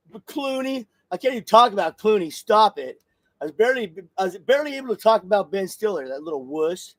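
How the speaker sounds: usual speaking voice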